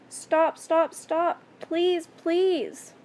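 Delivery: high, loud begging; screamed plea. A narrator's reading. Captive begs captor to stop, screamed plea. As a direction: cry out urgently, pitched well up. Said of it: "I screamed".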